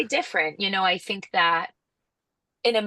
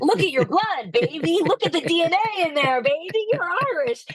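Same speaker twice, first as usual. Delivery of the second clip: high-pitched comic pleading; hammed-up exhortation, held high all through; mock impassioned insistence; 11 semitones higher, 3 dB louder than usual. American tells English cousins they are Irish, mock impassioned insistence.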